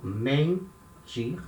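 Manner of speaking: mentir with a nasal e before the n; nasal sound in mentir. Shown as a demonstration. Teacher to students, modelling mentir with a nasal vowel. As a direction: push the en of mentir through the nose. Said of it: "the sound that comes from the nose".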